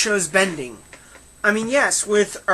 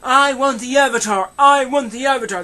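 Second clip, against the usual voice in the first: loud, higher-pitched one-note mimicry; mock Zuko; 5 semitones higher, 5 dB louder than usual. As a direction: mimic a one-dimensional character repeating his only line. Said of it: "he was pretty much bland. He's like".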